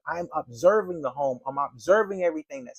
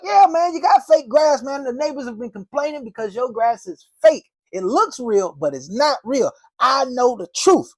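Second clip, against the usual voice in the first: mock accusation; 9 semitones higher, 7 dB louder than usual. Salesman teasing a man mowing his lawn, loud mock accusing. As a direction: tease him like you caught him.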